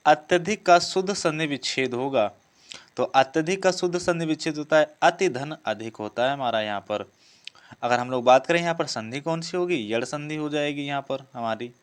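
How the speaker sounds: usual speaking voice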